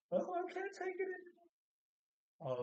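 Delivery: squeaky, very high mock sobbing; hammed-up tearful squeal; mock crying. Husband crying so much at the bedside, mock crying. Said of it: "he's crying so much".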